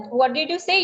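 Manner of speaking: usual speaking voice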